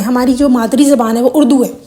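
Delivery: ordinary talk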